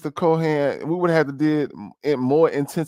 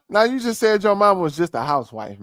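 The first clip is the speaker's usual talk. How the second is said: ordinary talk, nothing marked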